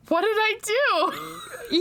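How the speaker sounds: clueless sister reacting; bewildered. Sister retelling her confusion at the angry mom, high and slow. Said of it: "And I'm still standing there like".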